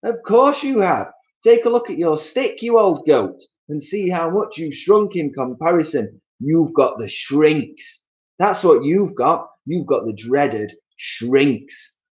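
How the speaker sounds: husband mocking his old wife; Mister Twit taunting. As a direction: savour the dreaded shrinks.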